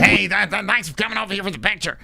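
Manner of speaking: mock the fighter thanking a fan; high caricature of a friendly fighter; Bilal Muhammad. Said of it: "Bilal's like".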